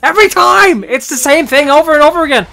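loud, high frustrated yell; exasperated shouting. Real, not acted. Gamer caught in the same trap every time, exasperated shout. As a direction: yell it at the game, pitch soaring. Trapped again in the fight.

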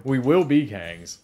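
slow, grand We will be Kangs with a flat a in Kangs; mock Kangs proclamation. Riffing on cohost's joke, slow mock proclamation of Kangs.

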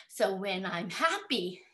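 ordinary delivery, nothing marked

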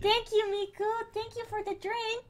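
high, very soft, doting thanks; cooing thanks. Real, not acted. Streamer thanks Miku for bringing a drink, cooing. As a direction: coo your thanks sweetly.

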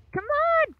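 very high, exasperated yell; high-pitched gamer exasperation; frustrated cry. Real, not acted. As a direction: cry out high at a game going wrong.

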